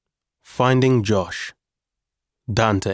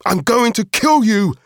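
high, loud, furious yell; furious shout; 12 semitones higher, 6 dB louder than usual. Acted. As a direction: scream it with murderous rage. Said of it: "I shouted".

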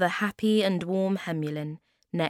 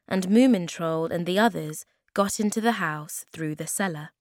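plain reading voice

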